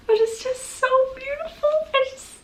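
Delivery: high-pitched gushing squeal. Real, not acted. Reader adoring a beautiful new book, gushing. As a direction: coo over it in a tiny high voice.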